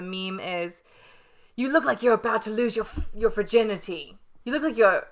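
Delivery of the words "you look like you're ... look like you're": mock Gordon. Voices Gordon's meme line.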